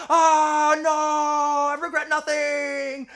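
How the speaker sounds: high, drawn-out mock death yell; hammed-up, defiant long wail; falling cry. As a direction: shout it while plummeting off a cliff. Hero shouts while falling to death.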